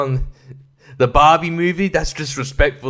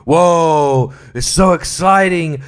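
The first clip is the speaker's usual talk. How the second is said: sarcastic excitement; 3 semitones higher, 5 dB louder, slower than usual. Pretend.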